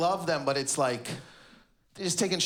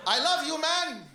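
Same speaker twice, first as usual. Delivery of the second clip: high, stiff, blurted out; awkward dad; 10 semitones higher than usual. Retold or imitated. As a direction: blurt it like a dad who can't say it. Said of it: "He's just standing there".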